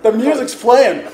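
laughing. Real, not acted. Laughing as music cues.

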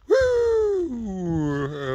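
voice crack. Real, not acted. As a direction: whoop that cracks high. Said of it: "Uh, voice crack".

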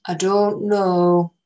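put-on old northern English man warning the girls; northern English accent. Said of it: "He spoke slowly and with an accent from further north".

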